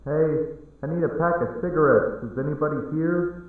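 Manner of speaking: high-pitched customer calling for attention; impatient-for-service call, pitched well up. A narrator's reading. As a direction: call out to find the missing clerk. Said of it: "the man calling out for service".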